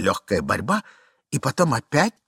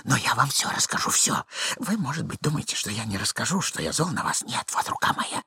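soft, breathy apologetic murmur; hushed, rambling nervous voice; timid, flustered man; 2 semitones higher, 5 dB quieter than usual. Acted. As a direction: plead in confusion, half whispering. Plays Ivan pleading in hiding.

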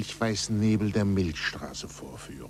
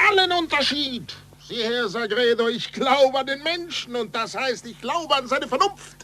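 much higher, fervent; ardent, raised outcry; passionate declaration; 13 semitones higher, 4 dB louder than usual. Acted. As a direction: proclaim your faith. Galileo passionately declares faith.

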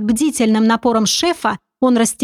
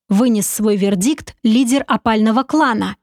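usual speaking voice

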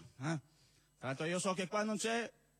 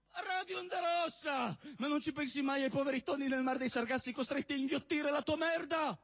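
outraged scolding; 6 semitones higher, slower than usual. Acted. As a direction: scream at him like a fanatic recycler. Acted eco-zealot scolding someone.

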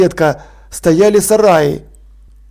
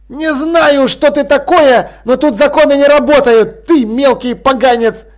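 threatening overseer; 6 semitones higher than usual. Acted.

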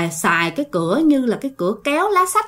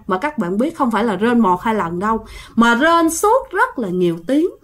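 plain reading voice

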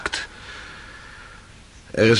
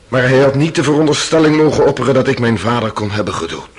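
fast, higher, louder protest; indignant protest; 5 semitones higher, 4 dB louder, faster than usual. Acted. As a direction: burst out against the suggestion. Accused protests to jury.

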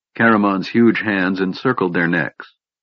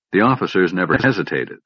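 ordinary delivery

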